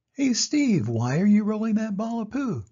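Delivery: high, eager call; curious animal. Acted. Animal hails Steve, high and eager. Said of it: "another animal asks".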